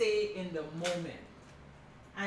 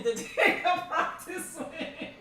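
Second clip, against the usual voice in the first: laughing husband; 8 semitones higher, 4 dB louder than usual. Retold or imitated. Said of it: "He's like".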